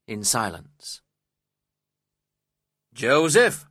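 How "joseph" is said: loud, high summons; sharp shouted call of a name; shouting. A narrator's reading. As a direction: shout for the servant to come. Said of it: "shouted Mister Heathcliff".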